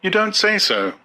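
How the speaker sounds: high, light polite murmur; polite Lenina. A narrator's reading. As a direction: humour him sweetly, voice up high. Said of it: "said Lenina politely".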